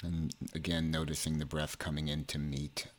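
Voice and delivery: measured meditation delivery, phrases spaced far apart; guided meditation. Acted.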